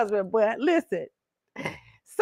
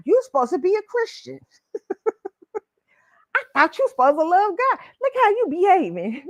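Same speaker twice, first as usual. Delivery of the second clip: mocking churchgoer; 10 semitones higher than usual. Boyfriend mocking her churchiness, high mocking voice. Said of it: "we be like".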